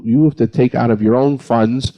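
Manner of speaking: usual speaking voice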